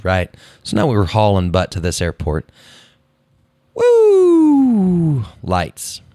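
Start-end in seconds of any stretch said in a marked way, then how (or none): 4.0-5.2 high, loud "woo" sliding down; wailing cop-car whoop; imitated siren. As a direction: mimic a police siren with the voice. Police siren comes on in story.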